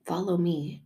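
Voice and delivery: soft reassurance. Acted. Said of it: "And he's like".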